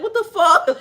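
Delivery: loud, high, laughing reaction; amused disbelief. Acted. Onlooker reacting to a big man's tiny voice, laughing disbelief. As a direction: burst out in baffled laughter. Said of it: "And you be like".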